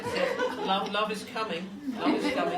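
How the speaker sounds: high and loud, laughing through the message; giddy laughing announcement. Real, not acted. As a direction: burst out laughing while passing on the news. Medium relays spirit message, laughing.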